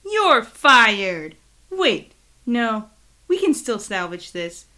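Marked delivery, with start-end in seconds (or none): 0.0-1.4 loud shouted firing; mock firing shout. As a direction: bellow it like a fed-up boss. Boss firing partner, shouted.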